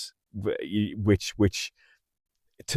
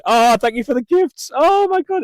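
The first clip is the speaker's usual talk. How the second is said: delighted surprise; 5 dB louder than usual. Real, not acted. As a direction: pitch jumps high, gasping thanks. Surprised by gifts on stream.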